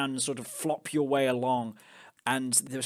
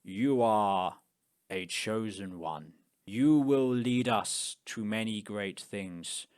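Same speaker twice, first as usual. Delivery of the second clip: low, slow prophetic proclamation; solemn cult leader, measured and halting; big bald leader; 5 semitones lower, slower than usual. Acted. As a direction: intone it slowly like a prophecy. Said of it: "big, bald man is like".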